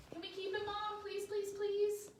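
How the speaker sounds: put-on high pleading child voice; begging. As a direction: beg Mom for the puppy. Worm begging Mom for dog.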